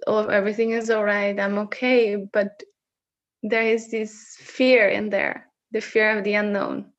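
ordinary talk, nothing marked

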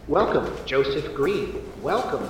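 calm automated greeting from the ceiling; disembodied voice. Acted. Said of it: "a voice from nowhere says".

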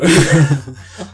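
loud, high laugh; burst of laughter. Real, not acted. Viewer laughing at the letdown, loudly.

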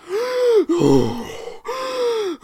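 loud, high wheezing gasps for air; hammed-up heaving breaths. Shown as a demonstration. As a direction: gasp noisily like you're badly winded. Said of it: "That was me being out of breath".

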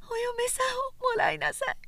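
high, quick, slurring woman's urging; drunken insisting. A narrator's reading. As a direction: nag him drunkenly. Drunk repetition.

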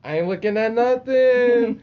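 high, loud, drawn-out wail; mock panicked denial. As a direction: protest your innocence in a stretched high yell. Retold frightened denial, shouted.

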